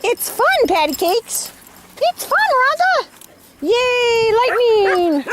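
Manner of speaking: high excited cheer; fake cheerful. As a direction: hype up the nervous dogs. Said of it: "make it sound like it's fun".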